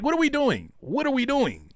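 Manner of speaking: high falling what are we doing; exasperated outcry. Real, not acted. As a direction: throw your hands up with it.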